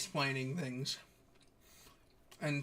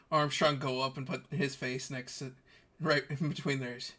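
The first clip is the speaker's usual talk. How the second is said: usual speaking voice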